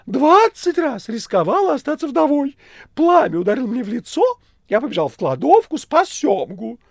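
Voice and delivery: very high, loud wheedling voice; cat Behemoth, boastful. A narrator's reading. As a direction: overplay heroic self-praise. (кот Бегемот хвастливо)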